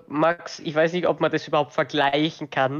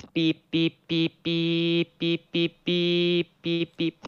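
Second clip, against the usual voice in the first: censor bleeps; much slower than usual. Pretend. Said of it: "Das war Morsecode für".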